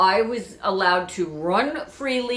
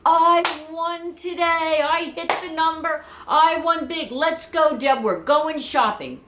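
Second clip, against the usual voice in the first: much higher, slow, louder winner's cheer; jubilant dad; 11 semitones higher, 5 dB louder, slower than usual. Retold or imitated. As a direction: crow about hitting the number. Father bursts in after winning.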